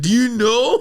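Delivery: high, loud, comic indignation; exasperated. Acted. Overworked guardian angel griping about keeping him alive, exasperated. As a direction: complain about an absurd workload at the top of the voice.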